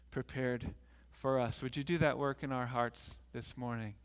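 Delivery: low, soft, earnest praying voice; prayer. Real, not acted. Pastor prays with congregation before the story, hushed prayer. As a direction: pray quietly and reverently, pitched well below preaching.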